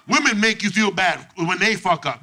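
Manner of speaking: ordinary talk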